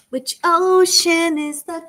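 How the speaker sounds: high slow sing-song question. Acted.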